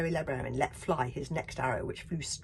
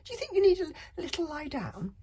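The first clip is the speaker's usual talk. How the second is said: much higher, coaxing question; sweet mock concern; 13 semitones higher, 6 dB louder, faster than usual. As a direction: pitch way up, sugary concern. Bertha asks sweetly.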